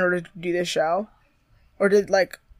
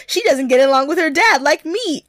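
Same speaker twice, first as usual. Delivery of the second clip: excited exclamation; 8 semitones higher, 10 dB louder than usual. Pretend. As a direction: read it higher and louder, gushing. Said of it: "my next note is, Exclamation point".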